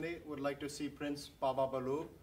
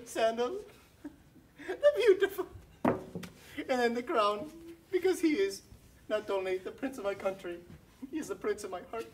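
gushing admirer; 7 semitones higher than usual. Acted. Servant swooning over her prince, high and adoring.

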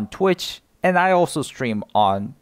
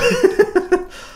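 high laugh; 4 dB louder than usual. Real, not acted. Laughing at mistake.

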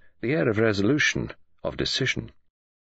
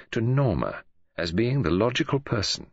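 usual speaking voice

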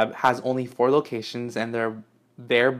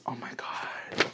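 breathless, soft whisper; whispered awe; 9 dB quieter, slower than usual. Real, not acted. Overwhelmed reading the gift.

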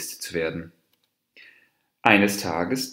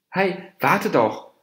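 high-pitched call to wait; 9 semitones higher, 3 dB louder than usual. A narrator's reading. Dwarf deer calls the departing monkey back, calling out. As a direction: call after someone leaving. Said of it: "rief der Zwerghirsch".

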